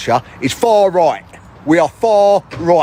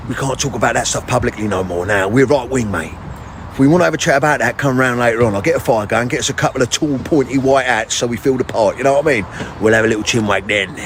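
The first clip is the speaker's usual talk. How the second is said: much lower, plotting in hushed tones; low conspiring bloke; 9 semitones lower than usual. Acted. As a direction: lower it down like a schemer.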